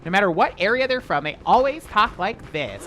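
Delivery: high, loud mock LA accent. Streamer mocking how every reality show contestant talks, exaggerated LA accent. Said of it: "L A accents for whatever reason".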